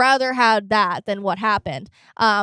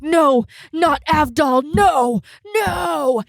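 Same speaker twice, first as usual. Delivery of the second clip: slow, louder, higher begging cry; mock panicked cry; 5 semitones higher, 4 dB louder, much slower than usual. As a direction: beg them to stop, panicked. Polnareff's panicked protest.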